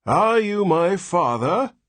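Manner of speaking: put-on high, slow, cracked old man; cracked ancient voice. Old newborn to his father, cracked ancient demand. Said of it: "spoke in a cracked and ancient voice".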